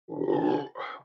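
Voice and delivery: low rumbling burp; real beer burp. Real, not acted. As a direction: let out a low burp from the fizz. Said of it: "Wow, bit more carbonation".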